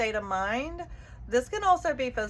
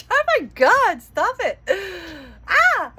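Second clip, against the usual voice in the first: much higher and louder, squealing with delight; excited shriek; 8 semitones higher, 13 dB louder than usual. Real, not acted. Reader squealing as the card confirms her message. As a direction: shriek in real disbelief.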